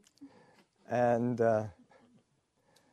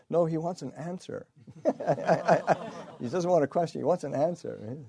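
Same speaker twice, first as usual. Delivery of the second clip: mock insisting monk; 7 semitones higher, 9 dB louder than usual. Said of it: "the monk sort of steps in".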